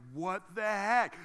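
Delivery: fed up with waiting; exasperated crowd. Acted. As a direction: draw it out in mock disbelief. Acted impatient people in line.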